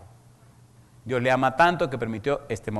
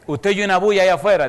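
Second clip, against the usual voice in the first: alarmed question; 7 semitones higher, 9 dB louder, faster than usual. Retold or imitated. Moribundo pregunta por el ruido.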